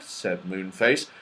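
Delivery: ordinary delivery, nothing marked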